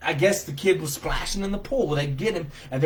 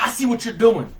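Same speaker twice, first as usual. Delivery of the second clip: raised, higher accusing call; retold loud confrontation; 7 semitones higher, 6 dB louder than usual. Bystander telling the man he's been spotted, raised voice. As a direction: call him out loudly from a distance.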